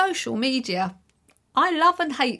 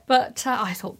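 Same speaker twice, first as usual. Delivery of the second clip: ordinary talk, nothing marked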